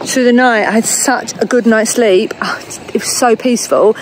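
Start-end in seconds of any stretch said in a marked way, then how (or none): none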